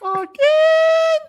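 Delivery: very high loud cartoon squeak. Cartoon voice bit.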